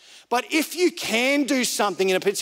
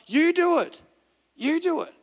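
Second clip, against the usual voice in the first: higher-pitched, insistent prompt; imitated inner voice; 8 semitones higher than usual. Inner voice interrupting the preacher's prayer, high and insistent. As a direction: cut in with a pointed, pitched-up command. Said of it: "I heard this voice say".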